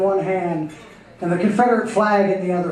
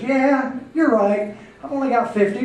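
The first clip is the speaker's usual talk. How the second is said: higher, nervous concession; meek agreement; 5 semitones higher than usual. Acted. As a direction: go along timidly, pitched up. Second bull meekly gives in.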